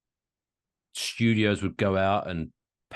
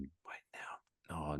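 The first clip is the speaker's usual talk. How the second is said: slow breathy whisper; 7 semitones lower, 18 dB quieter, much slower than usual. Real, not acted. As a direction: whisper it slowly. Realizing something.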